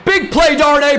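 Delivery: high, loud hyped call of the rhyme; excited shout. Real, not acted. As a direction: belt out the player's name like a fan. Hype call after the sack.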